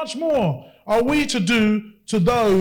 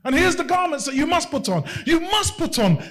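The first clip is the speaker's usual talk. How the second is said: fiery preaching; 5 semitones higher, slower than usual. Acted. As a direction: preach it with rising fervour. Preaching at full force.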